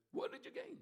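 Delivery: "What did you gain?" thrown up high in disbelief; incredulous high repeat. Acted. Repeated question, pitched high.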